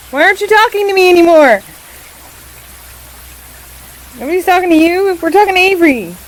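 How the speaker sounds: loud teasing banter between friends; playful loud protest. Real, not acted. Playful bickering over the cat.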